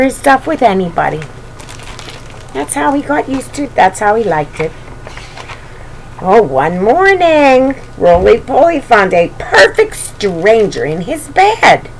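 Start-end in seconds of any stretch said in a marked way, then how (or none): none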